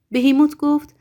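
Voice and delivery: ordinary delivery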